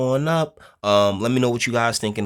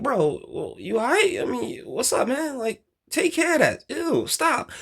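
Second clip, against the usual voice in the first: very high mock voice brushing him off; mock the bro waving away a crying man; dismissive bro; 14 semitones higher than usual. Men respond to a crying man, high dismissive mock.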